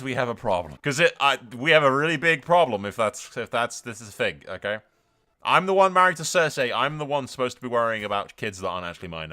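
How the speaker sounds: ordinary talk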